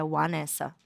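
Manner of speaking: Vanessa said with a W sound, like "Wanessa"; American pronunciation. Shown as a demonstration. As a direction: open Vanessa on a rounded W glide. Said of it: "é o correto".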